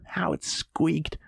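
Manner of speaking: slow whisper; slow gleeful whisper; whispered narration. A narrator's reading. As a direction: breathe it out slowly.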